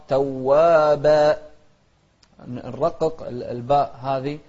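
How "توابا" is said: توابا with the و held double; doubled wa. Shown as a demonstration. Teacher showing the shadda in توابا. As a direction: lean hard on the doubled و of توابا. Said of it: "لاحظوا التشديد".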